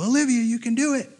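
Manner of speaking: high-pitched call from the sidelines; imitated cheer. Another table of friends to Olivia, high cheering call. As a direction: cheer her on from across the street.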